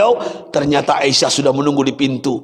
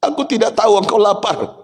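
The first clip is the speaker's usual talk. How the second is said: high, pleading, tearful cry; high anguished wife pleading; Aisyah weeping; 10 semitones higher than usual. Acted. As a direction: beg for punishment through tears. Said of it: "apa jawaban Aisyah".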